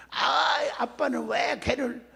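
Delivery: high, drawn-out protest to Dad; protesting child. Acted. Child objecting to father's advice, whiny protest.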